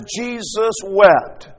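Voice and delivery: Jesus wept, drawn out very slowly; slow solemn reading. Shown as a demonstration. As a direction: let each word of "Jesus wept" hang in the air. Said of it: "The text says".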